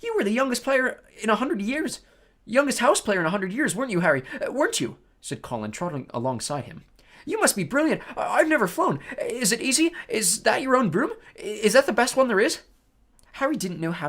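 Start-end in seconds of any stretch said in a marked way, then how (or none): 0.0-5.0 put-on eager boy voice. Colin gushing over Harry. 7.2-12.6 high, loud eager questions; excited boy voice. Acted. Colin peppering questions.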